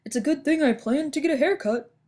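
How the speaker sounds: lightly voiced lion, pleased with himself; a hint of a cheerful lion. A narrator's reading. Dandelion speaks in story.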